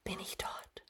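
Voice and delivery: barely audible whispered question; very soft, voiceless questioning; hushed whisper. A narrator's reading. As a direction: whisper it, almost without voice.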